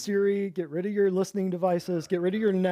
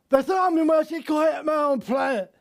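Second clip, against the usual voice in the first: higher, louder put-on rock-star voice; hammed-up mumbling rocker; 8 semitones higher, 4 dB louder than usual. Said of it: "I can picture him saying that".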